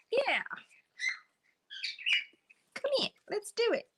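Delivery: very high squeaky egging-on; imitating a keen little bird; excited bird. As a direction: pitch it way up, eager to join in. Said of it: "Joker's all excited to join in".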